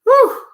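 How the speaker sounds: high, loud exhale of relief; big relieved whew. Retold or imitated. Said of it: "I'm like".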